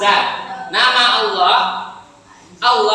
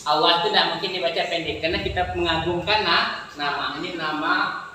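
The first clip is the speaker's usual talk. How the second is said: speaking much lower and softer; very soft aside; 8 semitones lower, 7 dB quieter than usual. Real, not acted. Teacher quietly explaining to nearby students. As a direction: drop to a hushed low murmur.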